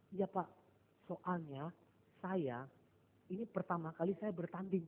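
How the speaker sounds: soft, hesitant confession with pauses; halting, hushed admission to the coach; imitating nervous athlete. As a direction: act out a timid first-timer owning up quietly. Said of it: "Iya takut ya".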